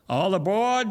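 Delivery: high, loud call to board; a conductor's call down the platform; conductor crying out. Acted. Conductor calls the boy aboard, loud and high. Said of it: "the conductor cried out".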